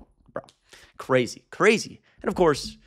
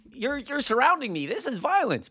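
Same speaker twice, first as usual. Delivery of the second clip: mock victim whine; 8 semitones higher than usual. Mocking the man's complaint.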